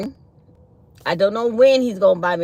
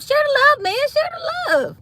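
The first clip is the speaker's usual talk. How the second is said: mock plea. Hammed-up plea, very high.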